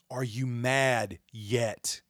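slow, deliberate question; slow, pointed repeat of the question; slow challenge. Real, not acted. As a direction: stretch each word out.

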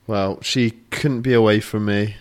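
ordinary talk

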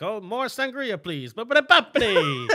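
breezy request ending in loud nonsense syllables; mock carefree diner. Retold mock order at Italian restaurant.

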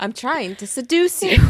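loud, high, hammed-up frustration; exasperated outburst, played for laughs; mock exasperation. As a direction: wail it in comic indignation. Acted exasperated line from book.

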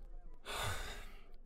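long, soft exhale of disappointment; weary sigh. Acted. Interviewer unimpressed by the hooligan's silly song, sighing.

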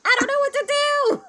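high, panicked mock voice; panicked performer. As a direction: squeak it out in a panic. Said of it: "'cause he's like".

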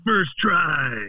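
lightly imitated movie hero's boast; Batman. Quoting Lego movie hero after endless misses, mock triumph. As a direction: drag it out like a triumphant claim. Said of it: "he's like".

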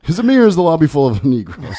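laughing, high and loud repeat of a quote; quick, high-pitched retelling through laughter; amused quoting. Retold or imitated. Viewer repeats a favourite one-liner from the show, amused and laughing.

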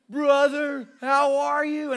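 grateful man greets the preacher warmly, excited; mock eager greeting. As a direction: greet like an overjoyed old friend. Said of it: "he's like".

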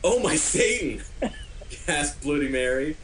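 ordinary talk, nothing marked

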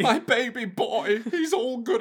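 high, drawn-out doting coo; gushing relief. Retold or imitated. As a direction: fuss over a beloved child, hammed-up. Acted doting, relieved parents.

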